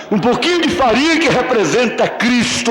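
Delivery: lifted pulpit delivery; preaching. Acted. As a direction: preach it up to the congregation. Rising sermon on oil, flour.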